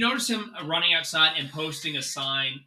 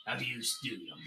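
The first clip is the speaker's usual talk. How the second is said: lower, softer, careful big-man voice; gentle giant; 8 semitones lower, 12 dB quieter, faster than usual. Acted. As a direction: ease down to a scared child. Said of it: "he's just like".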